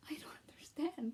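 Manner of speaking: soft unvoiced despair; dismayed whisper. Real, not acted. Fan lamenting the cute couple's death, whispered dismay. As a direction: whisper it, heartbroken.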